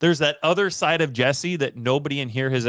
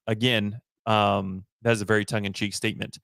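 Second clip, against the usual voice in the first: usual speaking voice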